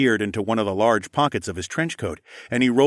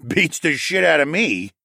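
grumbling; 7 semitones higher, 5 dB louder than usual. A narrator's reading. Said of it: "the trench coat man grumbled".

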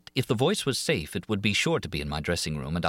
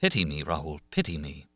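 low, soft, falling plea; 6 semitones lower, 3 dB quieter than usual. A narrator's reading. Christine begs Raoul for pity, low pleading. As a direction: beg for pity quietly, pitched down.

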